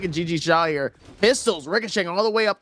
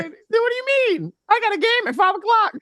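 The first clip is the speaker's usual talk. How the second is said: very high mock-panicked whine; indignant player impression. Caster voices the stranded player, high mock-desperate protest. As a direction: plead in a squeaky, hammed-up voice.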